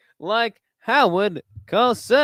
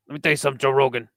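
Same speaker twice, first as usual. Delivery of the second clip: Joey Diaz impression; 5 semitones lower, much faster than usual. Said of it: "Joey Diaz, he talks like this".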